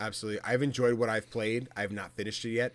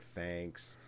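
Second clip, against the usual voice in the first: dry thanks; 2 semitones lower than usual. Retold or imitated. Mock weary thanks.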